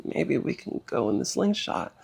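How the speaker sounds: put-on dying mother asks her child for a ride, softly; imitating a faint voice. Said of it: "barely be able to speak".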